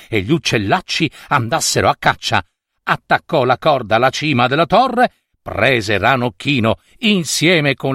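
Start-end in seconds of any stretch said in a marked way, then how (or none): none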